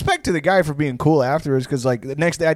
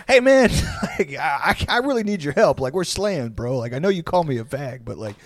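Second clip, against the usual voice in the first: pleading bar manager; 2 semitones higher than usual. Retold or imitated. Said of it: "It's like".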